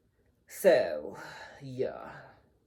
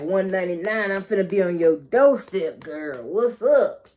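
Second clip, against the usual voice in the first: mock loud greeting; 8 dB louder than usual. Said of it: "We like".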